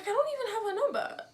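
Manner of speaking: high, loud, frantic insisting that she doesn't have "her number"; desperate high protest. Retold or imitated. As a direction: cry out that "I don't even have her number".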